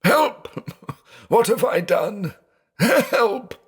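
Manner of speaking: very high, frantic calls for help; panicked cry. Acted. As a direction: cry out in terror. Blind man panics, breaks plate.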